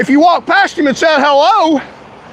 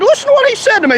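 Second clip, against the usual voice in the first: outraged; 10 semitones higher, much faster than usual. Real, not acted. Vlogger urging viewers to hear the sheriff's insult, outraged and high. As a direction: pitch jumps much higher, indignant.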